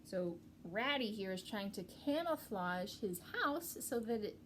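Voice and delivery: usual speaking voice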